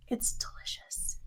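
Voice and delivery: slow, very soft whisper; hushed delight. Acted. Griswold to Galen about the soup, hushed delight. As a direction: whisper it slowly, savouring.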